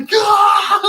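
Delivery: a high, loud crying wail; imitated anguished wail. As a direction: replay the breakdown as one sobbing cry. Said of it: "with tears coming down my face, ugly crying".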